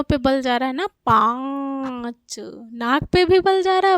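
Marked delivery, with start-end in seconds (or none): 0.9-2.4 पाँच with very long nasal आँ, separate च; nasalised vowel demo. Shown as a demonstration. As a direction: stretch the vowel of पाँच through mouth and nose. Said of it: "मुंह और नाक दोनों".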